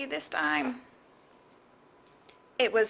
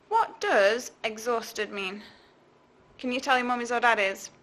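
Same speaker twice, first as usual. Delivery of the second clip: ordinary delivery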